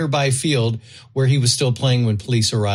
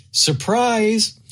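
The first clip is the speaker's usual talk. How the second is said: excited; 9 semitones higher than usual. Acted. Punchline reveal of snake story.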